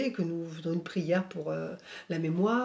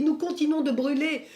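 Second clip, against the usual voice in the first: higher and louder, a retold voice in torment begging for help; anguished cry of pain; 8 semitones higher, 5 dB louder than usual. Medium on her knees pleading for the dead, anguished higher cry. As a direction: cry out as a burning soul pleading, pitched high. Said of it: "tombe à genoux en se tordant de douleur".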